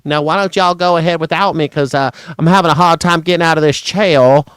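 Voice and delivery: put-on flustered Southern politician making excuses in a thick accent; hammed-up Southern gentleman impression; Lindsey Graham. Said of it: "I think Lindsey Graham probably gets real nervous".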